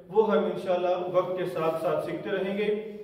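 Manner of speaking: ordinary delivery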